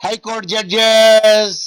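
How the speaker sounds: high, very slow quiz question; drawn-out quizzing. Real, not acted. Teacher quizzing the online class on judges, high and drawn-out. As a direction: call it out high, stretching the last word.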